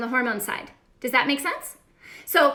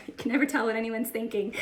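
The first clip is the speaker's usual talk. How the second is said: laughing; 2 semitones lower, 5 dB quieter, faster than usual. Real, not acted. Laughs about the mask.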